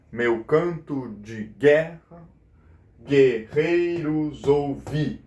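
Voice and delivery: very slow, measured verse; syllable-by-syllable scansion. Shown as a demonstration. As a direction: draw out each syllable so it can be counted. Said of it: "quantas sílabas tem aqui?".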